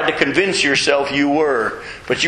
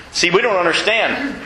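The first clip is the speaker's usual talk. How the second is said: usual speaking voice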